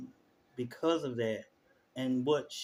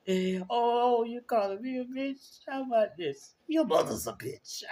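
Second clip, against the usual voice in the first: put-on much higher, sassy New York accent retort; Natalia impression; 10 semitones higher than usual. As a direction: copy the friend's spot-on New York voice, pitched way up. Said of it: "she sound just like her".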